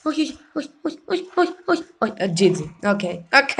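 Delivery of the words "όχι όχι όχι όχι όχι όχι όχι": high, soft string of quick refusals; nervous panic. Real, not acted. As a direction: squeak it out anxiously under your breath. Panicked pleading during a match. (πανικόβλητα, ψηλά και σιγανά)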